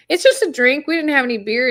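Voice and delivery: high, loud mock-defensive comeback; retold defensive protest. City girl excusing her cocktail to Lester, mock defensive.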